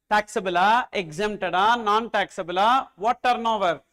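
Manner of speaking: normal talking voice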